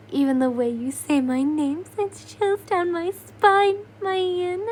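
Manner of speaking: high, loud purring flirt. Acted. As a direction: purr the words like a charmer. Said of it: "Doma purrs".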